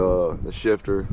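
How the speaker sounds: usual speaking voice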